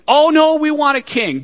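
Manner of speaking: put-on a touch of childish whining; whiny people. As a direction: pout it like a stubborn kid. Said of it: "and they whined".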